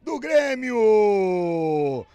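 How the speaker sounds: long stretched goal shout; explosive goal commentary. Real, not acted. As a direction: hold the goal call out loud. Own-goal call, stretched. (grito de gol arrastado)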